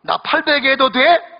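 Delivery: new bride sweetly asking her husband, Seoul manner. Retold or imitated. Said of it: "서울 신부가 신랑에게 얘기합니다".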